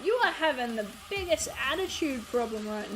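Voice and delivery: mock scolding the dog. Real, not acted.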